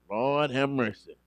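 normal talking voice

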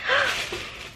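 sharp surprised gasp; delighted gasp. Real, not acted. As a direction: gasp at a lovely gift. Opens gift, sees bracelets.

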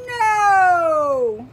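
big gasp then loud high no; mock gasp and no. Teacher reading to children, gasp then loud shocked no. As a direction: act shocked for the kids. Reacting to story.

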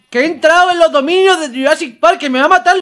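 high, loud alarmed rush; panicked yelling. Real, not acted. Gamer in Jurassic Park fearing being killed, panicked yelling. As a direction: shout it as if about to be killed. Panics as game creature approaches.

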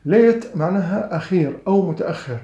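ordinary talk, nothing marked

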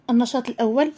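ordinary talk

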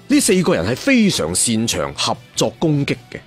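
ordinary delivery, nothing marked

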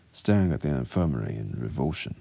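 plain reading voice

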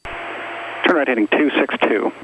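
usual speaking voice

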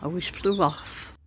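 ordinary talk

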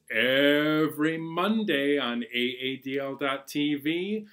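normal talking voice

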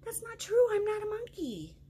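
mock protest. Reader protests again.

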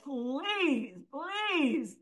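softly imitated plea, each please drawn out; gently beg, stretching the word please; retold plea, understated and earnest; pleading. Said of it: "almost like a pleading".

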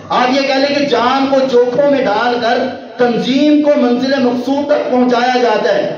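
ordinary delivery

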